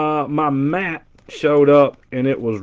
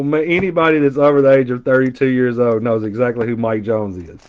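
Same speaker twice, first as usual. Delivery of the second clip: ordinary talk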